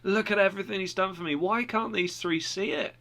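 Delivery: thrilled pilgrim talking to himself; excited. Retold or imitated. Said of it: "He was all excited".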